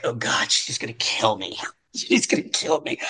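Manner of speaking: low, hushed dread, fading to a whisper; panicked mutter. Retold or imitated. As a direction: mutter it to yourself, sure you're doomed. Dread walking out of audition.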